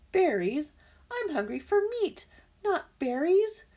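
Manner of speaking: high, loud, disgusted objection; scornful disbelief. Acted. Fox hungry for meat turning down berries, scornful.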